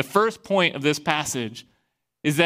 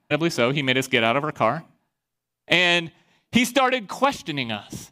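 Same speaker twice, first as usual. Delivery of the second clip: ordinary talk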